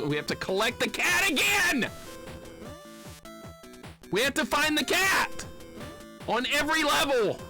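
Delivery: high-pitched outraged shouts; exasperated yelling. Real, not acted. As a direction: yell the complaint, phrase by phrase. Exasperated rant at the game.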